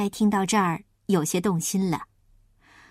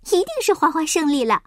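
quick, high, glad outburst; happy; 7 semitones higher, faster than usual. Acted. Little pig believes Huahua has won, happy. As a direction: jump in happily with rising hope. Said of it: "稀里呼噜又高兴地说".

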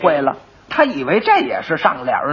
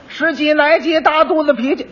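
higher sing-song cry of 十几来几大肚子脾气; lightly voiced hawker's call; 8 semitones higher than usual. Quack advertises in the street, calling out 十几来几大肚子脾气. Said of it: "又嚷嚷上了".

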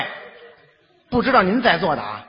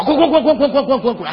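higher, louder hammed-up chant of 滚; repeated shouts of get out; 5 semitones higher, 4 dB louder than usual. Father chasing the boyfriend off, mock shouting. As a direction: drive him out with a string of yells. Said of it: "轰走了".

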